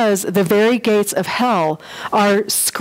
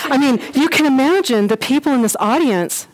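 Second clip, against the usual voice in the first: ordinary talk, nothing marked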